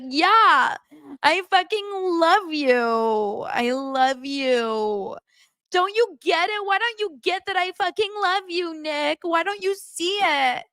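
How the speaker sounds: high, drawn-out mock wail; dramatic Annie. Annie insisting to Nick she loves him, slow high wail. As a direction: stretch every word into a slow, high lament. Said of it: "And she's like".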